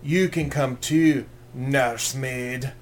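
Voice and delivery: put-on slow, drawn-out Scottish accent; mock Scottish warrior, every word stretched out; big Scottish guy. Warrior taunts the man left watching the prince, slow Scottish voice. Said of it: "turns to Dennis Quaid and goes".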